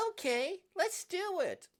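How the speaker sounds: high, loud, bubbly character voice; excited game-character squeal; eager agreement. Acted. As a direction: chirp it loudly, way up in pitch.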